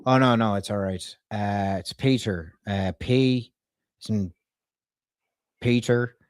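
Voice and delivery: spelling out a name in an Irish twang; put-on Irish brogue, letter by letter; Irish accent. Prank caller spelling a surname for a staffer, Irish accent. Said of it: "she really liked my Irish accent".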